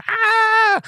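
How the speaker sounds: very high, sustained screech; imitated creature scream. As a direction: mimic a little attacker's war cry. Creature screams before charging with spear.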